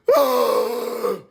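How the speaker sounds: loud, forceful blown-out breath; explosive breath release. Acted. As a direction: blow the tension out hard. Acts release in the car.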